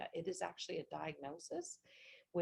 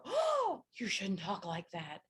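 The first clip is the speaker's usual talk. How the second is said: mock shocked; 2 semitones higher, 8 dB louder, slower than usual. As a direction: act out an exaggerated scandalized reproach. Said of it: "you just need to not go".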